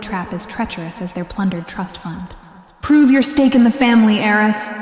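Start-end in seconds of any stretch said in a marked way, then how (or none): 2.8-4.7 loud, threatening hiss. Acted. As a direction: spit the words out as a threat. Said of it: "Felix hissed".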